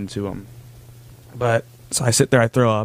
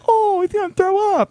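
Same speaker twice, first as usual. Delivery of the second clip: put-on high squeaky kid voice, much higher; mocking kid voice. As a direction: mimic a gawking little scout in a high squeak. Imitating kids watching him.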